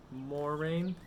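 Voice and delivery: ordinary delivery, nothing marked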